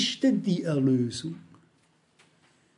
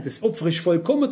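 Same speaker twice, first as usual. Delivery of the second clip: ordinary delivery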